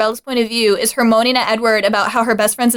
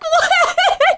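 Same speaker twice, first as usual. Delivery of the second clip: shocked what. Retold or imitated. Reader reacting to Bella's line with a shocked laughing squeak. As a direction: yelp it in laughing disbelief. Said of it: "And I'm like".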